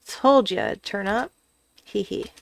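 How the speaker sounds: ordinary delivery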